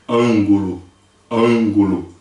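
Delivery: "Ângulo" with the closed first vowel of Portuguese; short Portuguese A. Shown as a demonstration. Teacher modelling a vowel for Portuguese speakers, closed A in "Ângulo". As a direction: model "Ângulo" slowly, falling at the end. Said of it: "seria como se pronunciasse ângulo".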